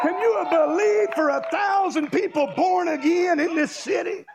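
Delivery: full-force preacher cry; revival shout. Real, not acted. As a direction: lift the crowd with it. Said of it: "building altar call".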